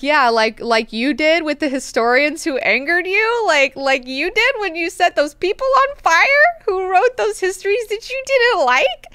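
nervous pleading servant. Retold or imitated. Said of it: "when he's like".

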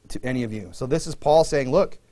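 normal talking voice

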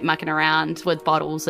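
ordinary delivery

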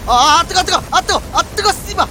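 loud high yelps; yelping in pain. Real, not acted. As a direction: yelp as if burned. Burned in game.

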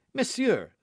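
eager, high-voiced approach; eager young D'Artagnan. A narrator's reading. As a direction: call for his attention, pitched well up.

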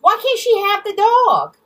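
high, loud retold plea; high-pitched imitation of desperate begging; imitated begging. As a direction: plead loudly on someone's behalf, retelling it. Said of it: "in front of the store begging".

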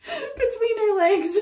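real laughing fit; laughing hard. Real, not acted. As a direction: dissolve into laughter.

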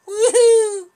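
high loud woohoo; excited whoop. Real, not acted. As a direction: whoop sliding downhill. Sliding down hill.

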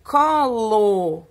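"Colo" stretched slowly, syllable by syllable; sounded-out reading. Shown as a demonstration. Teacher reads a word aloud to pupils, sounded-out. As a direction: read "Colo" loud and drawn out for beginners. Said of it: "leia com a prof".

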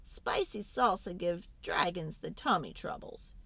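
plain reading voice

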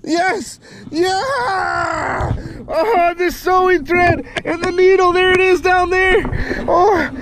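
high, elated shouting; real elation. Real, not acted. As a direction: crow over a hard-won catch, voice way up. Celebrates landing the fish.

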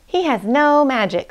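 high, dismissive retort; scornful hyena. A narrator's reading. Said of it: "said the hyena".

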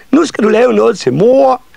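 put-on very high, loud child shout; clamouring children. A narrator's reading. Children demanding a gift for mother, high excited shout. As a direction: call it out as insistent little children.

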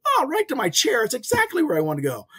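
high-pitched imitation of a pleased dad; amused mimicry of father's pleasant surprise; delighted father. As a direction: act out Dad's happy discovery, starting high. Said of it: "It was hilarious to listen to him".

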